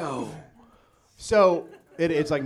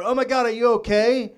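alarmed call; 10 semitones higher, 8 dB louder than usual. Retold or imitated. Alarmed call through door.

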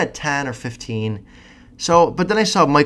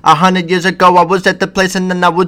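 mocking [name]; 6 semitones higher, 7 dB louder than usual. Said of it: "it's just the same".

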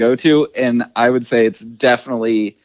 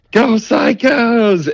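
yelling; 9 semitones higher, much slower than usual. Retold or imitated. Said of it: "hanging out the windows ... and yelling".

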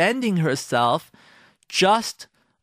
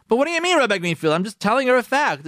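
higher, louder, fast protest; a husband pushing back indignantly; mock protesting husband; 8 semitones higher, 4 dB louder, faster than usual. Husband protests to the rabbi, mock indignant. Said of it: "husband objecting to the rabbi".

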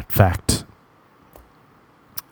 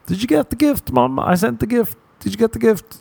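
much higher, pestering sing-song; fake innocent son; 13 semitones higher than usual. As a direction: needle mom about the gift, pitched way up. Pretend phone call to mom.

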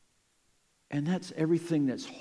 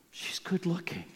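whispered thought; 4 semitones higher, 5 dB quieter than usual. Pretend. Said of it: "I'm guessing you were thinking".

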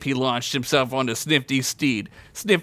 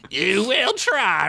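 slow, higher-pitched villain taunt; cool taunt; 8 semitones higher, slower than usual. A narrator's reading. Rick answering his enemy all cool like Anakin, slow taunt. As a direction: draw it out, stretched and high. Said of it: "all cool like Anakin did".

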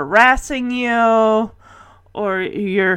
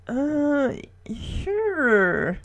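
hesitant, softer, higher "sure"; reluctant agreement; 4 semitones higher, 8 dB quieter than usual. Retold or imitated. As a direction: imitate an uneasy, halting yes. Retold reluctant answer to awkward question.

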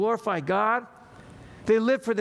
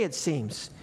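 ordinary delivery

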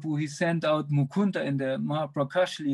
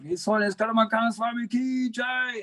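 shouted acclamation; 9 semitones higher than usual. Retold or imitated. Devotee hailing his guru in the temple room, re-enacted shout. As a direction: call it out high, stretching to the final jai. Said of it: "I was the first one who shouted out".

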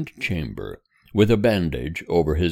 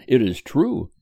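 higher, gently cheerful mother's voice; 6 semitones higher than usual. Acted. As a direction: say it lightly as the comforting mother. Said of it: "replied his mother cheerfully".

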